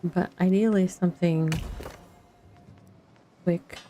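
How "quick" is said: ordinary talk, nothing marked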